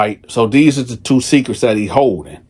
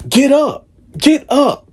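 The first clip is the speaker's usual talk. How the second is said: 1 urgent; 13 semitones higher than usual. Retold or imitated. Wife waking husband in alarm.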